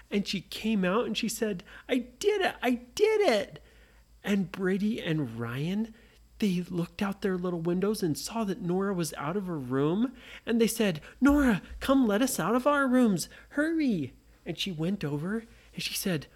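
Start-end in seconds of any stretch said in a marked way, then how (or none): 1.9-3.6 much higher victory cry; a kid cheering her own success; triumphant. Acted. Nora escapes the room. 11.2-14.1 higher, pressing call; trapped boys calling to a friend; urgent plea. Acted. Boys urge Nora.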